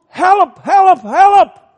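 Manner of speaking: full-throated high shout of help; crying out to heaven. Shown as a demonstration. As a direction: send each help up to heaven, loud and high. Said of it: "tot de hemel roept".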